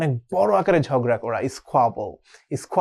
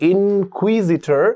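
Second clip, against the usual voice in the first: slow spelling drill; 4 semitones higher, 3 dB louder, much slower than usual. Shown as a demonstration. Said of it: "এর ক্ষেত্রে হচ্ছে ইনকুই".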